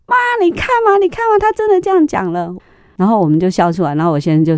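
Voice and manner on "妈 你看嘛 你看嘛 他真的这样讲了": put-on high, bright little boy crowing that he was right; excited child. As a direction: voice the son, much higher, bursting with told-you-so glee. Son voiced, excited told-you-so.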